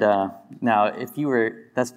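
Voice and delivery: usual speaking voice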